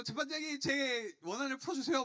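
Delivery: high, loud mock plea; pleading widow. Widow asking the judge to avenge her, loud pleading. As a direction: plead with the judge, pitched well up. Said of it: "막 이러는 거예요".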